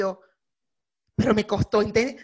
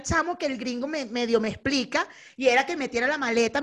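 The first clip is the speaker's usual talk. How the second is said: ordinary delivery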